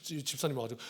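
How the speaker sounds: normal talking voice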